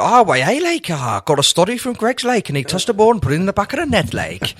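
hammed-up, lilting Geordie pundit impression; Geordie accent. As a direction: do a comic Geordie footballer, rattling it off. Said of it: "Alan, what did you think?".

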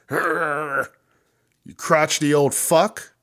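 put-on sneering at a grumpy old star; crotchety insult. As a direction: drag out the insult with scorn. Ranting at imagined Bruce.

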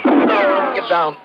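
shouted duck-for-cover warning; urgent shout. Acted. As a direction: yell it as the shot rings out. Shot fired at them.

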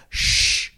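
a sharp, breathy shh to hush a loud neighbour; mock shushing sound; imitated shush. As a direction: shush someone like an annoyed fan in the row ahead. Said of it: "turned around and went".

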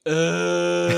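loud, drawn-out groan of reluctance; mock groan at being asked out; reluctant groan. Husband asked by wife to walk the dog, groaning reluctantly.

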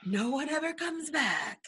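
high, slow doomsayer warning; slow ominous raspy drawl. Pretend. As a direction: drag out the grim warning. Doom prophecy, Crazy Ralph style.

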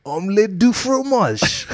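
high, slow cartoon French accent; hammed-up French like a cartoon boy genius; fake French accent. Asked for his best French accent, slow hammed-up French. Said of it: "What's your best French accent?".

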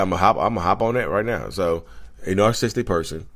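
normal talking voice

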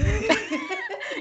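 bright giggling burst; high laugh. Real, not acted. Laughing about being lazy.

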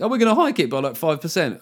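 sarcastic impression of the plan; lightly mocking politician; mock government voice. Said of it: "allowing the business community to think".

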